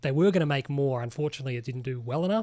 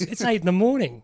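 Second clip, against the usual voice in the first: pitched up and louder, a put-on voice; deadpan character quote; 5 semitones higher, 6 dB louder than usual. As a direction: deliver the comeback in a mock character voice. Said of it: "He goes".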